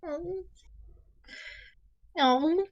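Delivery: high, slow cooing, acted as moved; extra touched. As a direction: coo it tenderly, holding back tears. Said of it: "extra touched when he says that".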